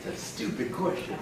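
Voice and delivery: hushed, near-whispered mock reaction; muttered disbelief. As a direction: mutter it like a private thought. Voices Keith's silent reaction.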